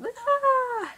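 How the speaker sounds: sudden high shriek of alarm; short mock freak-out scream, very high; panicked scream. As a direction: shriek like your brain just broke. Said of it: "my brain just goes".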